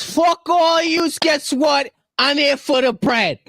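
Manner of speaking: high, slow wiseguy boast; Italian mobster voice. Retold or imitated. Voicing the new leader in his mobster accent. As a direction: gloat like a mob boss. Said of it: "new leader boasting".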